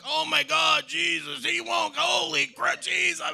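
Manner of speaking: mock-frantic friend, high and loud; hammed-up high-pitched moaning; frantic complaining. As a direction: imitate someone wailing in exasperation. Imitates overdue pregnant friend complaining.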